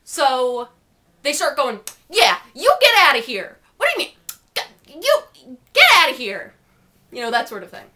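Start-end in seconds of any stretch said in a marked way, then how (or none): 2.1-6.5 mock angry shout. Lads telling him to leave.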